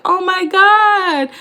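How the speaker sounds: high, drawn-out oh my God; slow stunned disbelief; a kid caught out, slow and high; mock shocked gasp. Quoting Elio's shock.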